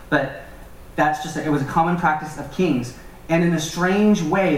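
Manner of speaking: ordinary talk, nothing marked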